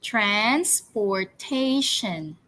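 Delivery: Transportation stretched out syllable by syllable, very slow; teacher slowly modelling Transportation for pupils to repeat; slow, drawn-out model. Shown as a demonstration. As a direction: spell out Transportation slowly for the class, each syllable clear. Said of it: "One more time".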